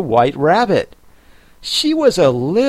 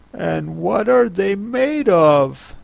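curious; 6 semitones higher than usual. A narrator's reading. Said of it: "in a tone of great curiosity".

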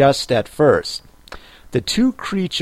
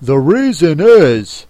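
loud, emphatic creature voice; Griffin; 3 semitones higher, 6 dB louder than usual. Acted. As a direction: explain it forcefully.

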